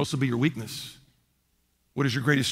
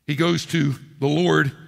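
ordinary talk

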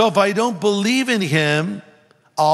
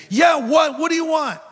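high-pitched, impatient imitation of an interrupted yeller; irritated mock yell; 11 semitones higher than usual. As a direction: act out snapping at an interruption, pitched way up. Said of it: "he's still yelling".

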